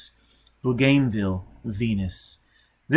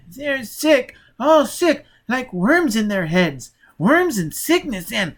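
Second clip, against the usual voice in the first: put-on high, strained child's voice; a scared little boy pleading; high whimpering child, slow on the worms; frightened child; 11 semitones higher, 5 dB louder, slower than usual.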